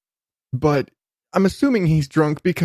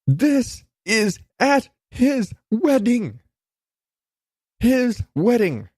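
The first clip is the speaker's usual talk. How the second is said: slow and high, leaning hard on wedding; emphatic disbelief; 8 semitones higher, slower than usual. Real, not acted. Host to listeners, slow, high, appalled emphasis. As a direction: drag it out, hitting his wedding. Ranting, hammering the point.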